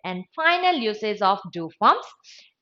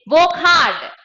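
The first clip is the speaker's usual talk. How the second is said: loud, raised, brisk order; unsoftened command; 5 semitones higher, 6 dB louder than usual. Shown as a demonstration. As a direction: command it with force. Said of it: "it becomes softened".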